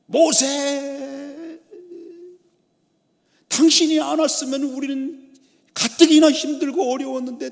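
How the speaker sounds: high, loud acted outcry of a blaming crowd; resentful complaining. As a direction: wail the accusations at a leader in protest. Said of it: "막 원망하고 막 욕을". (높은 목소리로 원망하며 따지듯)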